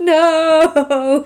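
long, high wail of dismay; drawn-out, laughing, pretend-anguished no; mock horror. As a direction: cry out in hammed-up horror. Joking horror at licence renewal fee.

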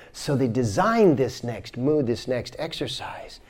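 usual speaking voice

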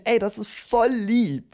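high, slow, sweet and touched; cutesy touched voice. Acted. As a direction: sound flattered and melted. Acting the blushing emoji's reaction.

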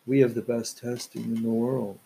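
low, hushed parody of a politician's boast; mock impression. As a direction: murmur the boast in a low caricature voice.

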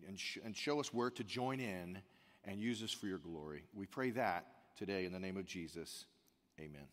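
soft, low, unhurried prayer voice. Acted. Pastor opening the service with a hushed prayer. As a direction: pray quietly over the congregation. Pastor prays before sermon.

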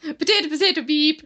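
high, loud fake Irish babble; exaggerated Irish caricature; mock Irish accent. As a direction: chant it in a silly stage-Irish voice. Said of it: "they say potatoes and they say beep".

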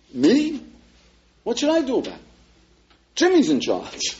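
high, mock-incredulous shrug; a hammed-up "who, me?". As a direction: play the shrugging young man, voice up high. Imitating indifferent young man.

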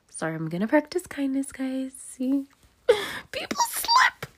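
very high, squeaky little voice; mock-sweet apology. Mock-sweet apology to viewers for slipping.

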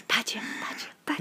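breathy whispered chant; whispering. Pretend. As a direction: whisper it like a spooky jingle. Show jingle for Badger Watch.

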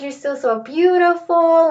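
high, loving grandma compliment; tender grandmother. Retold or imitated. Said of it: "She's like".

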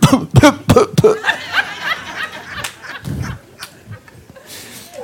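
loud, driving preacher's call; full-force preaching. Acted. Preacher warning the congregation, loud and driving. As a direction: preach it hard over the music.

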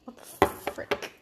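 soft unvoiced whisper. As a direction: breathe it in a hush. Hushed echo of the story.

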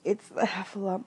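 a touch of small-creature nerves; nervous Piglet. A narrator's reading. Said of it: "thought Piglet nervously".

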